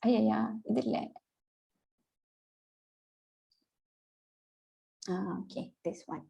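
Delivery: soft muttered aside while searching; muttering to herself. Real, not acted.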